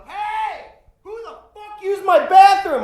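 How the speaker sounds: high, very loud enraged yell; furious scream. Retold or imitated. Drunk kid screaming at the party guests about his bathroom. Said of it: "Like that".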